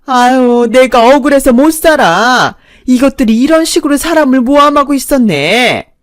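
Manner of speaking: loud, high hammed-up cry of injustice; mother-in-law protests loudly to the police; stubborn wailing protest. A narrator's reading. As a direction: play the victim at full volume. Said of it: "억지를 부렸고".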